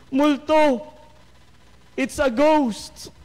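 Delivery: high-pitched startled cry of ghost; frightened cry. Acted. Disciples in the boat seeing Jesus on water, high frightened cry. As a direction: shriek it like terrified fishermen.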